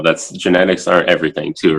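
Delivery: ordinary talk, nothing marked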